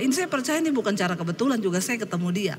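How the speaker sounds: ordinary talk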